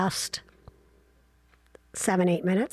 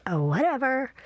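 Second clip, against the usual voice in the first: usual speaking voice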